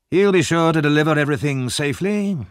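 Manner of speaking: said with a smile. A narrator's reading. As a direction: assure them with a smile. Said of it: "he said with a smile".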